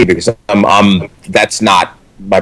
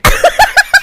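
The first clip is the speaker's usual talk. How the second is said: squealing high laughter; laughing at the memory. Real, not acted. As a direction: burst out laughing. Laughing mid story.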